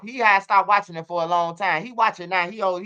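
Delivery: normal talking voice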